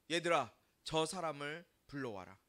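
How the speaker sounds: low, measured instruction; Jesus. Acted. Jesus telling his disciples to fetch the man, calm and low. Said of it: "예수님이 제자들에게 말씀하세요".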